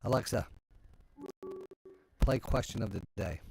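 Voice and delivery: talking to voice assistant. Real, not acted. Commanding Alexa to play.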